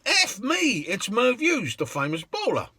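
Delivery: high, excited gasp of recognition; starstruck. Retold or imitated. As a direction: blurt it out, amazed at who just got in. Starstruck cabbies spot Merv Hughes.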